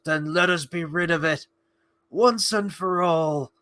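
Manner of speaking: slow, put-on heroic resolve; Sam Gamgee. Imitating Sam urging Frodo on, slow put-on resolve. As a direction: draw it out as an impassioned imitation. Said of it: "And then Sam goes".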